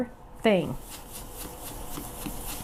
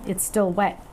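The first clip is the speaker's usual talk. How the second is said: usual speaking voice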